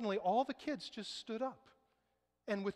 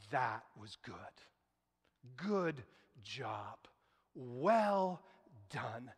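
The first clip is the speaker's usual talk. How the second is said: gentle praise; 9 semitones lower, much slower than usual. Retold or imitated. Teacher praising messy preschool class, low and gentle. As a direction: lower and soften into an encouraging well done. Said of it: "here's what she's gonna say".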